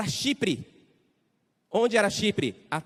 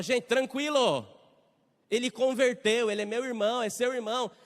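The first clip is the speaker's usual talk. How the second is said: reassuring; 6 semitones higher than usual. Acted. Barnabas calms frightened Christians.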